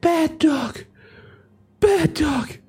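very high, strained falsetto with gaps; hammed-up squeaky dying voice; wounded man scolding. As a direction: play a dying man feebly scolding a dog. Dying gunman scolds the dog.

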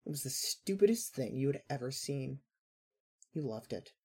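ordinary delivery, nothing marked

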